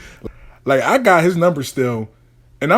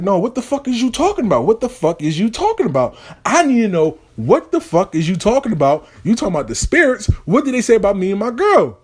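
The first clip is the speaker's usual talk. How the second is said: pitched way up, pressing and indignant; high agitated demand; 7 semitones higher than usual. Acted. Boy demanding the root worker explain himself, high and agitated. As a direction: demand answers, voice climbing with alarm.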